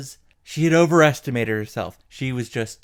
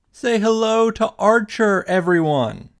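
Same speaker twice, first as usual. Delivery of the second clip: high, bright presenter's call; mock-cheery announcement; show-host introduction; 9 semitones higher, 3 dB louder than usual. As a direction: present him to the crowd, pitched up. Introducing Archer to the audience.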